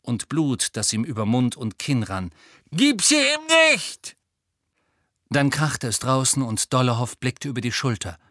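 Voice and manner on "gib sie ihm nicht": put-on high, slow, loud boy's voice with a broken nose; Neville, furious and hurting; angry young Neville, much higher and louder. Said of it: "sagte Neville wütend".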